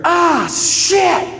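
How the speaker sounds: high, drawn-out yelp of pain; mock high-pitched cry of pain; pained outcry. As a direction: imitate dad's startled cry as he gets hit. Dad hit by flying catfish fin.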